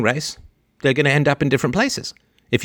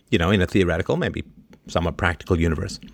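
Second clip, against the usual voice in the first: usual speaking voice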